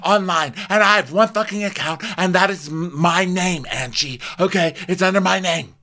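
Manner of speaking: high, loud mock outrage; indignant denial. Angie denies bullying anyone online, indignant high protest.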